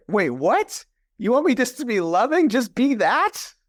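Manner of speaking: mock incredulous, as if the answer can't be that simple; retold disbelief. Guest retelling to the host how he questioned his coach, mock incredulous.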